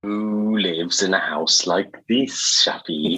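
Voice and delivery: slow, drawn-out TV presenter impression; Lloyd Grossman. Guest teases the host as a TV presenter, mock impression. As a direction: stretch the words like a quizzing presenter. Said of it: "My sort of Lloyd Grossman".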